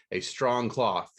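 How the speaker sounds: usual speaking voice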